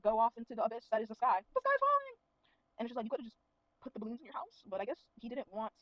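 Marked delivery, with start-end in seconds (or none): 1.4-2.2 high, loud mock panic cry; Chicken Little panic. Mocking falling boulders, shrieking like Chicken Little in panic. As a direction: squeal it like a frantic cartoon chicken. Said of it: "Like, we're Chicken Little".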